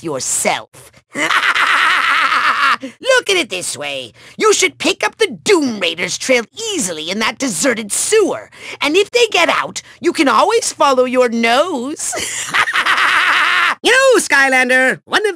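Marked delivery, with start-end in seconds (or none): 1.1-2.8 long staccato evil laugh; gloating cackle. Acted. Villain relishing the Skylander's smelly task, gloating cackle. 12.2-13.8 high, pulsing evil laughter; villain cackle. Acted. Villain amused by his nose joke, high cackle.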